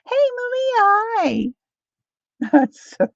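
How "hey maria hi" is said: very high, sing-out hello; high greeting. Acted. Greeting Maria in mid-chat, high-pitched call.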